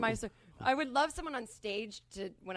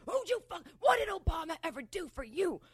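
high, loud outraged shout; imitated angry screaming; 8 semitones higher, 5 dB louder than usual. Friend's husband berating sister over her vote, screaming. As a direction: yell it like an irate man losing his temper. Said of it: "so he's screaming at her".

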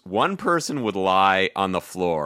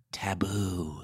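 soft, hushed word; whisper; 8 dB quieter than usual. Shown as a demonstration. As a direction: whisper it like something forbidden. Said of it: "what are you gonna whisper".

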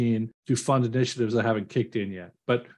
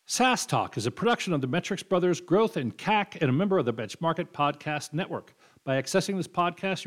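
mock-legal podcast credits read; scripted outro read; 4 dB quieter than usual. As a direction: read the show's disclaimer like official small print.

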